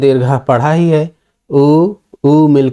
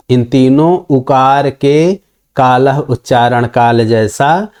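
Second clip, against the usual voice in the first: normal talking voice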